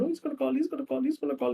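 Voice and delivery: high, quick excited chant; eager anticipation. Retold or imitated. Girl waiting by the phone, eager and high. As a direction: bounce with hope. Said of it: "she's like, she's looking at the phone".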